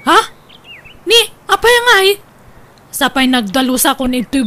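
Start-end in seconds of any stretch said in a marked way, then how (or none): 0.1-2.2 startled. Acted. Hears the trip is cancelled.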